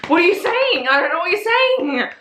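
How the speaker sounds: hammed-up flustered plea; panicked confusion.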